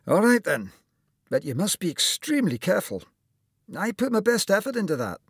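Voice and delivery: lightly voiced cheery doctor; kindly old surgeon. A narrator's reading. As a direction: play the jolly surgeon greeting his patient. Surgeon warns Tom.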